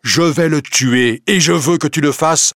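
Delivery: high, loud furious vow; murderous resolve. Acted. Husband telling wife he will kill, loud and furious. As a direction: declare the killing with fury.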